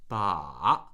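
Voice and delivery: "Ba." with a low trough, then a rise; falling-rising "Ba." with a clear turning point; third-tone demo. Shown as a demonstration. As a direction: dip "Ba." deep and turn upward. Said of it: "has this little inflection point".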